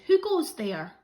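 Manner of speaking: loud challenge from the rat; cried out. A narrator's reading. Rat calling out to the rabbit, loud challenge. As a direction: call out to stop the traveller. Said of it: "who cried out".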